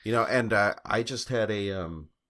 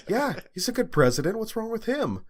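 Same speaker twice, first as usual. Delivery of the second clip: high-pitched mock teen protest; imitated indignant son. Son argues back at his mom, mimicked indignant protest.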